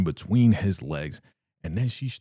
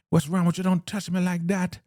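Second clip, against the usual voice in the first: shrill-edged get-off-me protest, pitched up; indignant woman; 10 semitones higher than usual. Retold or imitated. Joan resisting being grabbed.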